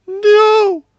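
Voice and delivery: very high, full-force scream. A narrator's reading. Daughter dragged away from the condemned man, screaming. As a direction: scream in desperate protest. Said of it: "I screamed".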